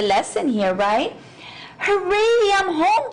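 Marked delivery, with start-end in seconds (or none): 1.8-3.1 cheering. A narrator's reading. Bee cheers arriving back at the hive. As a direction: burst out happy to be home. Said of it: "cheered the greedy bee".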